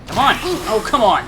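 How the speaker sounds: loud exasperated yell; frustrated shout. Real, not acted. Frustrated during fight.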